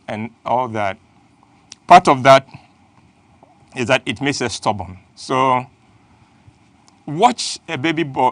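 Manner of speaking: normal talking voice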